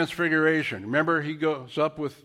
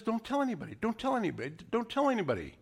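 repeated hush; 6 semitones higher, 7 dB quieter, much faster than usual. Retold or imitated. Repeated hushed command.

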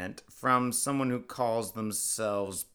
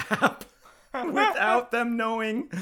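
laughing; 11 semitones higher, 3 dB louder than usual. Real, not acted. Breaks up reading.

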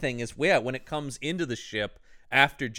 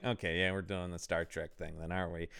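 low, much softer sarcastic mutter; sarcastic aside; 8 semitones lower, 11 dB quieter than usual. Retold or imitated. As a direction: drop the voice and roll your eyes at the cliché. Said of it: "I'm going like".

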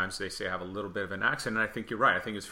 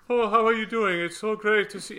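much higher, louder, put-on tenor chatter; affected tenor; 11 semitones higher, 5 dB louder than usual. Mocking how opera tenors talk offstage, high affected gushing. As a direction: gush like a vain opera tenor greeting someone. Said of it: "especially tenors".

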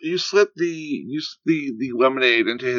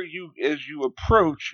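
ordinary talk

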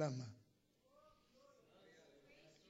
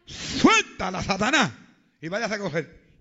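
loud commanding rebuke of Satan; rebuking shout; 13 dB louder, slower than usual. Retold or imitated. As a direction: cast the devil out with a shout. Rebuking the devil in prayer.

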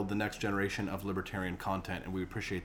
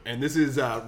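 usual speaking voice

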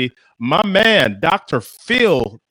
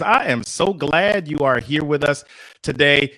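usual speaking voice

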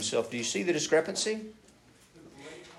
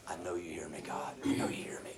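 much softer, fading to a whisper; quiet confident prayer; 9 semitones lower, 10 dB quieter than usual. Retold or imitated. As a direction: hush it like a private prayer. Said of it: "it's almost like".

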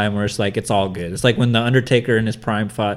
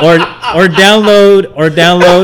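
infomercial; 6 semitones higher, 8 dB louder, much slower than usual. Acted. Hammed-up plug, laughing.

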